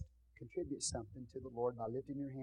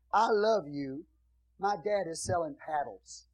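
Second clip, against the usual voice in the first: excited letter reading; 8 semitones higher, 15 dB louder than usual. Retold or imitated. Reading a child's letter aloud.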